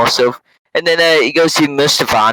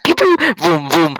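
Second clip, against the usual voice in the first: high, playful mouth sound effects of lasers and engines; pew-pew and vroom like a kid playing spaceships; blaster noises; 5 semitones higher than usual. Kid retelling the movie, making high blaster and engine noises. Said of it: "and it's like".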